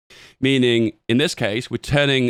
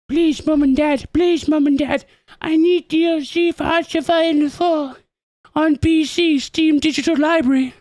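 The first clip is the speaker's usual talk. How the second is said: put-on high, whiny pleading child voice; begging kid; 14 semitones higher, 3 dB louder than usual. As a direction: beg like a little kid asking for games. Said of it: "when you go up to your mum and dad and go".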